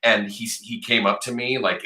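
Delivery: ordinary delivery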